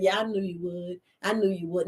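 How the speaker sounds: smug Denzel. Acted. Denzel voiced, smug reply.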